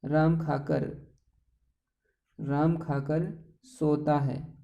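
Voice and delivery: very slow, spaced-out sentence; slow example. Shown as a demonstration. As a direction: lay out the sentence word by word. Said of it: "जैसे मैंने आपसे कहा कि राम". (बहुत धीमे उदाहरण वाक्य)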